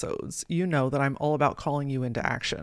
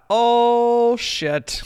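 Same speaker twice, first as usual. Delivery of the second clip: higher and louder, caught out; startled oh shit; 7 semitones higher, 7 dB louder than usual. Retold or imitated. Realizing the mentor saw through her, a loud startled oh shit. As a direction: let it jump out, busted. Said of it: "And I thought".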